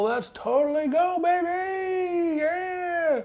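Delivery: high drawn-out squeal of a cheer; playful excited cheer. Pretend. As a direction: let it soar way up, held long. Excited cheer about the fair.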